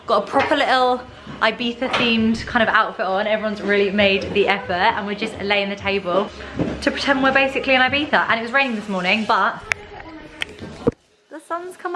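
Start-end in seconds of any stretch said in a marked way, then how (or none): none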